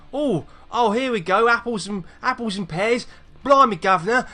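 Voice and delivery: hammed-up high cockney accent; mock cockney accent. Mocking how Americans fake British accents, high cockney. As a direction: lay on a fake Cockney twang. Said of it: "accents ... is always awful".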